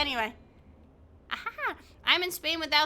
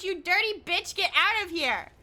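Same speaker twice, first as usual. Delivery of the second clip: usual speaking voice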